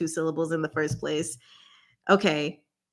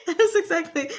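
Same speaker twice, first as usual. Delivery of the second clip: laughing; 9 semitones higher than usual. Real, not acted. Laughs at partner's answer.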